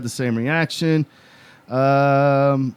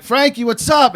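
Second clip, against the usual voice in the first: teasing call-out; 10 semitones higher, 4 dB louder than usual. Retold or imitated. Teens calling out to Frankie.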